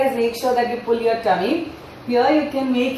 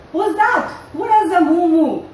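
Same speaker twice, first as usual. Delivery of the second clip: put-on reading to a toddler; 4 semitones higher than usual. Animated storytelling for little kids.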